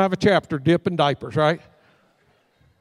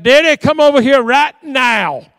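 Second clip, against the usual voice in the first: a loud, higher, slow call across the church; yelled summons; 5 semitones higher, 8 dB louder, slower than usual. Retold or imitated. Vanessa calling her pastor over from the second row, loud and slow. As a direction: summon him from across the sanctuary. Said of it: "she yelled".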